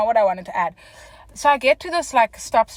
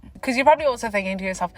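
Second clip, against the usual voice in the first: ordinary talk, nothing marked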